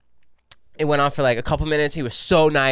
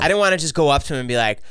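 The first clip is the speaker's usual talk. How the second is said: usual speaking voice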